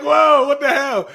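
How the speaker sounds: high, loud startled yelp; startled people. Retold or imitated. People startled by Cancer, high loud yelp. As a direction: yelp it in shock.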